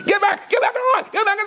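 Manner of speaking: high, strained mock scream; imitated screaming. Cop screaming at old lady offering water, acted scream. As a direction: shriek the repeated order, hammed-up. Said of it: "he's screaming".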